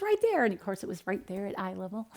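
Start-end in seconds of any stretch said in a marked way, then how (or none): 0.0-0.4 put-on high-pitched eager kid; insistent little son. Voicing her small son, insisting.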